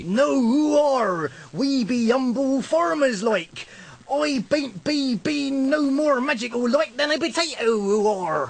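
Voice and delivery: put-on overdone country farmer accent; feeble yokel accent. Wizard protesting he is unmagical, fake yokel accent. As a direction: ham up a bumpkin accent. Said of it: "You have absolutely feeble accents".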